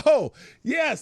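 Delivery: light up as it comes back; excited recall. Real, not acted. Host remembering the old commercial, excited.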